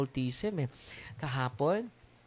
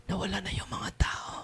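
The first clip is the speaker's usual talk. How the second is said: amused whisper; 6 semitones higher, 5 dB quieter than usual. Retold or imitated. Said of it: "Sabi ng mga deliverance ministers".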